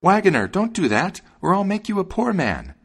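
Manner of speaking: high, loud warning; high-pitched urgent threat; sparrow crying out. A narrator's reading. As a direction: cry out high as an alarmed bird. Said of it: "the sparrow cried out".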